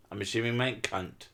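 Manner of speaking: normal talking voice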